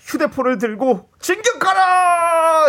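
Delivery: high, slow shouted battle orders; loud gung-ho soldier. Acted. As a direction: command the listeners like troops. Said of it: "소리 지르고".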